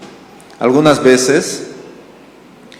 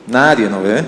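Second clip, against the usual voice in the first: usual speaking voice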